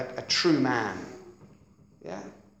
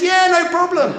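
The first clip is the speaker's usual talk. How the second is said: high, loud breezy yes; carefree eager agreement; 10 dB louder than usual. Retold or imitated. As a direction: toss it off cheerfully, much higher. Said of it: "I said".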